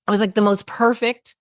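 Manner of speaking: ordinary talk, nothing marked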